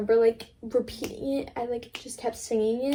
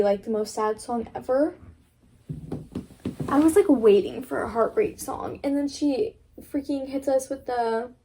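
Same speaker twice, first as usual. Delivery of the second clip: ordinary delivery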